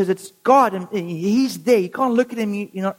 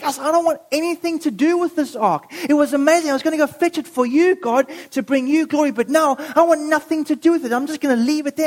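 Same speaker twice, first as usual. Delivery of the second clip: higher, huffy rejection; acted sulking protest, pitched up; upset; 4 semitones higher, faster than usual. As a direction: throw it all back at God, aggrieved. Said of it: "He's so upset".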